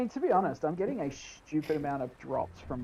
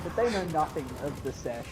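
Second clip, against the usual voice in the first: usual speaking voice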